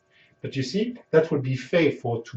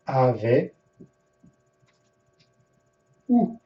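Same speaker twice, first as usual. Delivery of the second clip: slow word by word. Shown as a demonstration. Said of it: "Moi, ta ra main fait yon ti parler avec vous".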